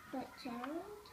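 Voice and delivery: usual speaking voice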